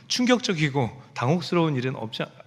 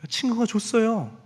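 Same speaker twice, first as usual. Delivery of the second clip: put-on child's answer; 6 semitones higher, slower than usual. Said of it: "너 이거 장난감 어디서 났어?".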